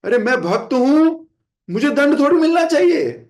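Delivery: ordinary devotee complaining he deserves no punishment; mock indignant protest. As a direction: play the entitled devotee offended by punishment. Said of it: "हमारा क्या consciousness होती है".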